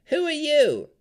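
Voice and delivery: high, loud harsh crone voice; harsh hag voice. A narrator's reading. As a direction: snap it out like a suspicious hag. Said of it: "said a harsh voice".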